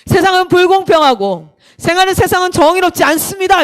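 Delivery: acted pulpit conviction; pastor's declaration. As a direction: proclaim it to the congregation. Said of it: "이렇게 얘기하는 거예요".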